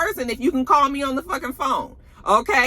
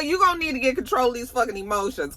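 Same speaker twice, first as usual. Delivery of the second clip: ordinary talk, nothing marked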